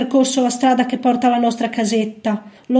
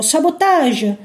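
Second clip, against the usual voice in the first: usual speaking voice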